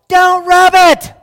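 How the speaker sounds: team yelling. Retold or imitated. Little League teammates yelling at the batter, high loud shout. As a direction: yell it across the field. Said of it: "everybody yells".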